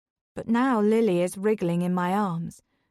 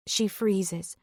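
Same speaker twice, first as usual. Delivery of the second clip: ordinary delivery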